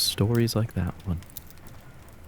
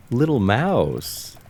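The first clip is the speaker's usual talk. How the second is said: slow, drawn-out laughing little mouse; laughing taunt; 6 semitones higher, 3 dB louder, much slower than usual. Acted. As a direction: laugh the pet name at your captive. Villain laughing at captive.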